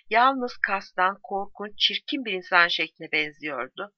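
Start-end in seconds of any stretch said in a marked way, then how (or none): none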